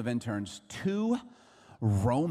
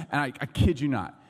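ordinary talk

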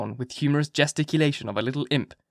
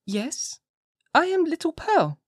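put-on very high small-girl voice; little child; slower than usual. A narrator's reading. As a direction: answer as a capering little child. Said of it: "repeated the child".

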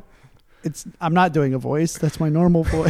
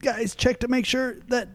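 crazy voice; 5 semitones higher, 7 dB quieter than usual. Acted. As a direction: ham up a manic storyteller. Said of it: "you don't have to do the crazy voice every time".

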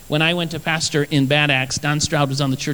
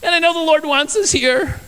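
high mock tearful wife voice; a hammed-up weepy wife; imitating crying wife; 10 semitones higher than usual. Pastor imitating his wife crying over the carpet, mock tearful. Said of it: "She goes".

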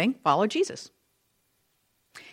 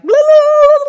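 loud, high babbling outburst; imitated explosion of crowd uproar; crowd-erupting noise; 13 dB louder, slower than usual.

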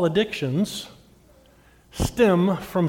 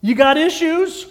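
high, loud, ringing call of the catchphrase; preacher's catchphrase; 11 semitones higher, 8 dB louder than usual. Shown as a demonstration. Preacher declaring his catchphrase to the congregation, loud and high. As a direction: sing it out big like a punchline. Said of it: "do you know what I say?".

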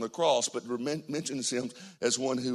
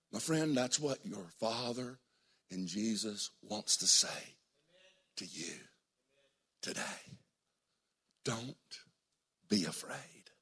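soft, low, slow comfort; quiet pastoral tenderness; intimate hushed appeal; 3 semitones lower, 7 dB quieter than usual. Acted. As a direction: lean in and console.

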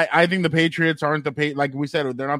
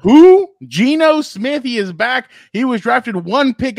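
excited; 6 semitones higher, 8 dB louder, slower than usual. Real, not acted. Hyped announcement of his pick.

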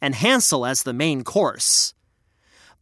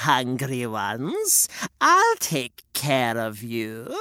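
slow, low, soft crooning; coaxing old lady; 5 semitones lower, 3 dB quieter, slower than usual. Acted. Witch promises the children care, soft croon. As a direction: lull them in. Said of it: "said the old lady".

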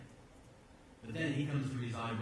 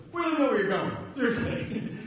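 much louder and higher, fed-up protest; exasperated outburst; 11 semitones higher, 9 dB louder than usual. Retold or imitated. Thomas cries out to Jesus, loud and exasperated. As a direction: blurt it out, fed up and lost. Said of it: "Thomas goes".

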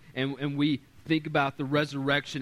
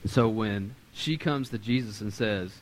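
ordinary delivery

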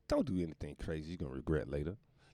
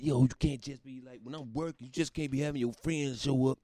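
higher, lightly voiced angry actor; mock Johnny rant; 6 semitones higher than usual. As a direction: imitate him scolding his girl, a touch heated.

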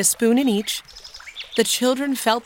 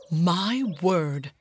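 exclaiming; 2 semitones lower than usual. A narrator's reading. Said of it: "he exclaimed".

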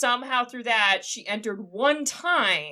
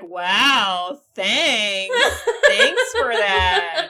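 slow sarcastic thanks, laughing; drawn-out mock gratitude; 3 dB louder, much slower than usual. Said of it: "being sarcastic".

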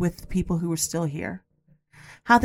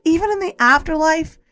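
pitched higher, hurt and indignant; exasperated rant at dad; 6 semitones higher than usual. Retold or imitated. Retelling her angry plea to her late father, exasperated. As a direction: throw the question at him, fed up. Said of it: "I got so angry".

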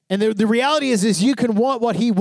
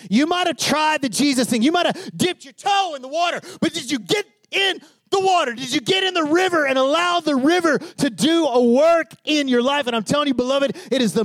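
much higher, near-shouted sermon climax; preaching at full force; 8 semitones higher than usual. Acted. Pastor urging the congregation to get in the river, fervent preaching. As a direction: drive the challenge home to the congregation.

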